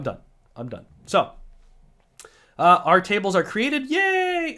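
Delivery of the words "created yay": high, drawn-out cheer; hammed-up little hooray; mock celebration. Mock celebration of created tables.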